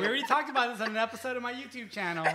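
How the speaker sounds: high, loud, fast exasperation; exasperated gush. Retold or imitated. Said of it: "I'm like".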